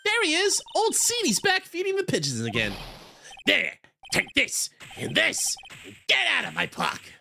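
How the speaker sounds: put-on high, shouted old man; excited old man yelling. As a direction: yell like a cranky old man.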